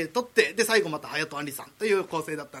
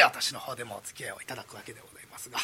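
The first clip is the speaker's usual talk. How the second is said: whispered aside; 8 semitones lower, 10 dB quieter, faster than usual. Real, not acted.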